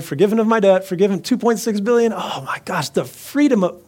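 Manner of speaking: high, giddy rejoicing; elated skipping debtor. Acted. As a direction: revel in being let off, pitch way up. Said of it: "he's skipping down the road".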